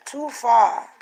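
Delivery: "Too far" лениво протянуто с ямайским акцентом; a light Jamaican accent on "Too far". Pretend. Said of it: "in Jamaican accent".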